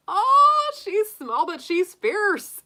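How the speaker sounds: high, loud delighted gush; excited. Real, not acted. Reacting to a viewer's Leo dragon sign, she gushes in high delight. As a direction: squeal it with delight.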